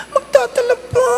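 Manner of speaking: high squeaky disbelieving yelp; squeaky mock dismay at bringing a gift; mock shock. Parishioner's shocked reaction voiced.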